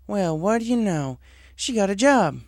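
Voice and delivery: tickled to see her; Applejack, amused. Acted. Applejack spots the sleeping Rainbow, pleasantly surprised.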